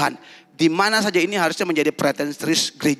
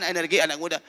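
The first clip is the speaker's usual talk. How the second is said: ordinary talk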